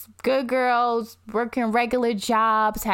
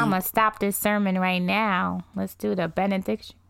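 ordinary delivery

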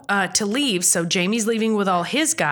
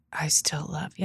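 soft whispered confession; lovelorn whisper; 5 semitones lower, 7 dB quieter than usual. Retold or imitated. Leary confesses as Jamie walks away, lovelorn whisper. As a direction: whisper it longingly behind someone's back.

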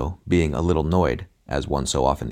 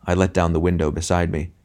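ordinary delivery, nothing marked

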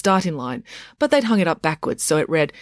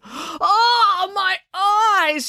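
gagging cry; much slower than usual. Acted. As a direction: cry out like your eyes are burning from the sight. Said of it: "she gagged".

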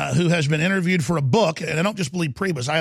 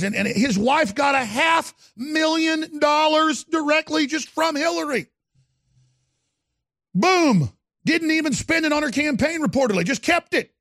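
ranting shout; 8 semitones higher than usual. Real, not acted. Host ranting about the money.

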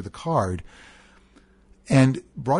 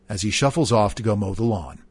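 ordinary talk

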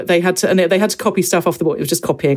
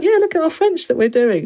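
high, proud, cheerful showing-off; chirpy teacher voice; 12 semitones higher than usual. Retold or imitated. Retelling the primary teacher showing off her class's French, high and chirpy. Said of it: "And she's like".

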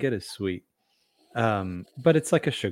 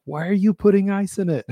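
incredulous listener voice; 11 semitones higher, 6 dB louder than usual. Retold or imitated. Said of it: "they'll be like".

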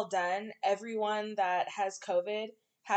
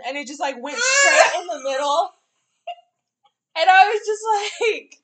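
loud laughing cry, higher; real cracking-up yell, much louder and higher; laughing shout; 5 semitones higher, 10 dB louder than usual. Real, not acted.

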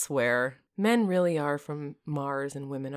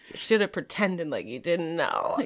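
ordinary talk, nothing marked

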